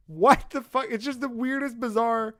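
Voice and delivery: high-pitched, exasperated disbelief; real bafflement. Real, not acted. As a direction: burst out loud, then stay high and baffled. Baffled reaction to his own story.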